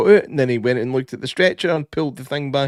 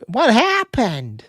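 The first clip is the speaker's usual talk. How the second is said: drawn-out question; 9 semitones higher, much slower than usual. Retold or imitated. Sheriff voiced asking.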